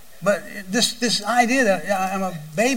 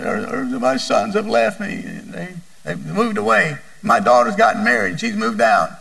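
imitating a weeping mother, pitch up; mock sobbing complaint; lightly voiced crying lady; tearful quote; 4 semitones higher than usual. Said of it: "And she was just crying".

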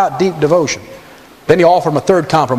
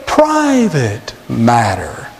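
higher, slow, oily tempter with a rising question; Satan's voice; 5 semitones higher, slower than usual. Retold or imitated. Preacher voicing Satan whispering to the believer, higher and slow. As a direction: persuade sweetly, end on a higher hook. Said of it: "here's the voice of Satan as it whispers in your heart".

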